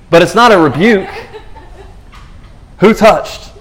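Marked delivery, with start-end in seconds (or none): 2.8-3.5 high, loud searching question; startled asking. Retold or imitated. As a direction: call out looking round the crowd. Jesus asks in crowd.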